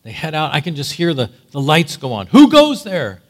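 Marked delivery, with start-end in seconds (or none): 2.3-3.2 loud, high sentry shout; shouted challenge. Acted. Guard shouting at the raiders in the night, loud. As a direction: call out loud into the dark.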